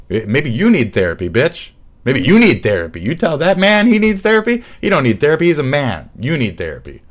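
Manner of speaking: loud, high, mocking bro ranting about therapy; mocking dumb guy. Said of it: "upwards and say".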